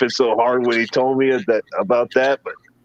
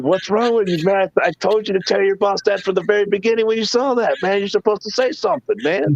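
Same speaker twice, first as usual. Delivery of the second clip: mock scolding; 8 semitones higher than usual. Scolding friend after firing.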